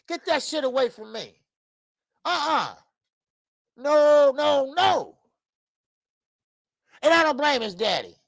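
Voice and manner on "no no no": ordinary delivery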